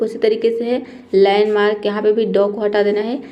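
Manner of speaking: ordinary delivery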